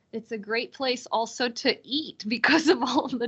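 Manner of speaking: chuckling as she says it; a real laugh breaking through the words; amused, laughing through the line. Real, not acted. Laughs while praising local food.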